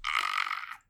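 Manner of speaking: low, loud creature "aah"; loud monster noise, pitched well down; lizard folk cry. Acted. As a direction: drop deep for a lizard's wordless greeting. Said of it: "he looks at you and he goes".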